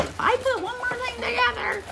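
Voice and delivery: playfully mocking a husband's complaint; mock exasperated husband. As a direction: imitate a fed-up husband. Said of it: "I know Jeff is gonna go".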